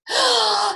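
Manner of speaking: loud, high gasp of alarm; sudden storybook intake of breath; horrified gasp. Acted. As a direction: gasp sharply in mock fright. Snake hears he may be eaten.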